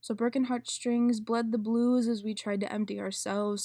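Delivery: loud, intense slam poem delivery; spoken word poetry. Acted. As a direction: perform it like a slam poet on stage.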